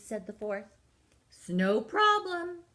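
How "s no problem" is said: joking cat. Acted. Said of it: "said Cat, cracking himself up".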